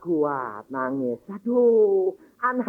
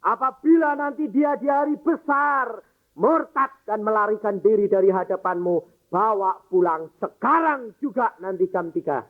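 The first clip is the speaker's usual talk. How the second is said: raised, higher voice of earnest prayer; fervent prayer; 5 semitones higher than usual. Acted. Father commits his dying daughter to God, fervent and raised. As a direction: plead with God, full of conviction.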